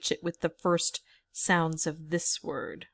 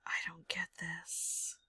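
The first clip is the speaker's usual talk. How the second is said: whispered frustration, much softer; whispering; 3 semitones lower, 13 dB quieter than usual. Real, not acted. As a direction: breathe it out, puzzled. Confused muttering at puzzle.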